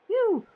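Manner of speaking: high burst of relief; loud relieved phew. Real, not acted. Streamer relieved after a scary fight, high phew. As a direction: let out relief after a scare.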